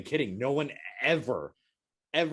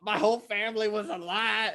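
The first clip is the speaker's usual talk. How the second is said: high, drawn-out dying wail; hammed-up, high-pitched death throes; mock cardiac arrest; 11 semitones higher, slower than usual. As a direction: wail it as if your heart is giving out. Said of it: "I go into cardiac arrest".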